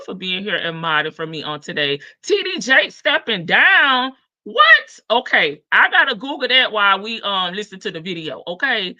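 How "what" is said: high, loud, shocked "What?"; a real jolt of surprise, yelped. Real, not acted. Reacting to a chat message with a shocked yelp.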